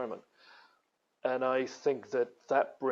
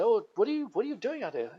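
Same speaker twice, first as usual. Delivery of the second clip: friendly curious American; 8 semitones higher than usual. Retold or imitated. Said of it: "They came up and said".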